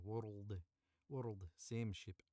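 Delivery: slow Scottish world rolled in the throat, then same ship; Scottish warm-up phrase. Shown as a demonstration. Voice actor finds his Scottish accent again before the line. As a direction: drag out world with a Scottish burr. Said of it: "wordled and girdle".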